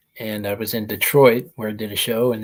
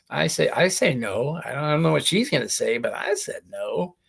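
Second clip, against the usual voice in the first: usual speaking voice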